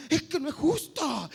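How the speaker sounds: high, loud, drawn-out protest; aggrieved pupil. Retold or imitated. School pupil protesting to staff, loudly indignant. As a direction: cry out like a pupil who feels wronged. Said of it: "escuchar estas palabras".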